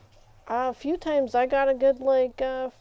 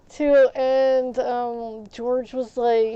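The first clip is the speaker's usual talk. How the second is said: ordinary talk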